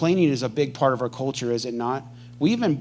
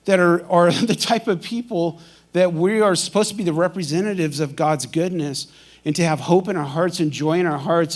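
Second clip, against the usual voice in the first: ordinary talk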